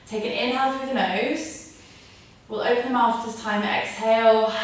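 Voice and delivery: calm breathing guidance; yoga instruction. Acted. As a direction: lead the class through each breath. Guides a breathing exercise.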